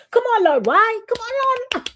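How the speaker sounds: high loud plea to the Lord; high, loud pleading with God; pleading cry. Retold or imitated. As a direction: cry out, begging why. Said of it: "sometimes I'll be like".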